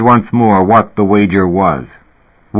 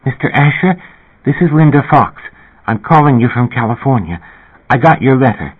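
put-on higher, shy young woman on the phone; timid singer calling a fan, higher and breathless; 5 semitones higher than usual. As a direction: speak up high, shy and a little out of breath. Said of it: "a shy, breathless voice said".

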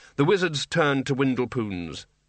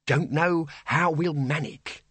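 slow, higher, far from heartfelt farewell; thankful bursar; 4 semitones higher, slower than usual. A narrator's reading. Bursar bidding old Windle farewell, thankful. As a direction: draw it out, lightly relieved. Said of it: "said the bursar, thankfully".

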